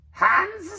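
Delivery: high, loud creature guess; high creature blurt; Gollum guess. A narrator's reading. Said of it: "said Gollum".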